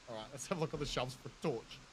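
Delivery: ordinary talk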